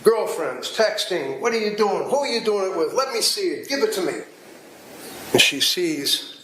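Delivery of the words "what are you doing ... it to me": higher-pitched imitation of a prying wife; mock interrogation, each demand starting high; suspicious demands. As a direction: fire off accusing questions in a raised pitch. Acts suspicious wife demanding phone.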